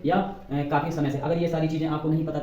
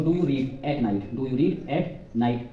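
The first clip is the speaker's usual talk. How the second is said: slow dictation; 3 semitones lower, much slower than usual. Shown as a demonstration. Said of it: "तो मतलब study करेंगे ना हम".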